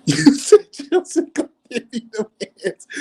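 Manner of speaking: high, loud words broken by laughter; laughing hard. Real, not acted. Repeating a viewer's comment while cracking up, laughing hard. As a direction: squeal it out through real laughter.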